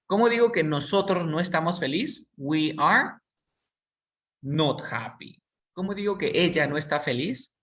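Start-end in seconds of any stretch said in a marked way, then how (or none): 2.3-5.4 "not" hit and held, then happy slowly; "I am not happy" with "not" slowed and stressed. Shown as a demonstration. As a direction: lean on the word "not" for the class. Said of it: "voy a ponerle solamente el not".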